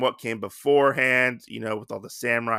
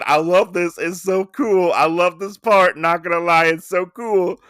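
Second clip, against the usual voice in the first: higher, louder fan gushing; thrilled, raised voice; real excitement; 5 semitones higher, 5 dB louder than usual. Real, not acted. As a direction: gush about your favourite part. Gushing over a favourite panel.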